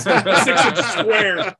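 laughing. Real, not acted. Laughing at crowded map.

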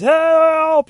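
high, loud cry for help; panicked yell. Acted. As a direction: scream it as you run away. Character flees in panic.

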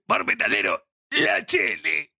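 slow, drawn-out announcer parody; mock announcer voice. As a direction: announce it like a metal show. (voz de locutor exagerada)

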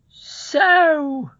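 high, loud, drawn-out challenge; indignant housekeeper. Acted. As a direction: pounce on the intruder in a high voice. Voices confrontational housekeeper.

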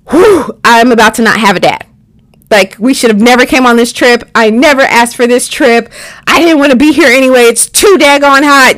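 worked-up inner protest. Retold or imitated. Kid fearing for dad on the trip, worked-up.

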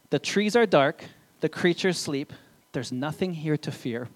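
gentle storybook reading, unhurried; reading to children. Acted. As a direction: read it like a bedtime book to little ones. Reads a children's book aloud.

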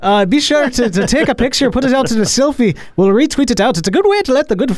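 playful mock Irish accent running through a sponsor plug. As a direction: ham up a cheery Irish brogue for the Irish listeners. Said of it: "that was a good accent".

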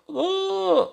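high delighted oh; crowd oh. Acted. Festival crowd opening their mouths, delighted. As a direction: gasp with pleasure.

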